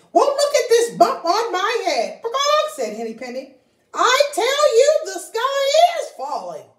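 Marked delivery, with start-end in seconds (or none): 0.1-2.8 high, fretful hen voice, tail word squawked; fussing anxious little hen; worried hen. Acted. Henny Penny fretting to Ducky Lucky about the weather, high and fussy. 3.9-6.7 high, loud insisting hen; insistent hen. Acted. Henny Penny insisting to Ducky Lucky, high and loud.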